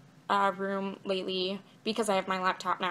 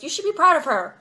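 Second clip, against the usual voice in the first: higher and louder, a put-on stage voice; lightly mock his in-character line; imitating the actor; 7 semitones higher, 7 dB louder than usual. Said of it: "and he goes".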